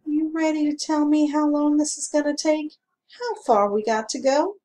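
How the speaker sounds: sleepy lawman mid-yawn. Acted. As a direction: yawn through the question. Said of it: "yawned deeply and said".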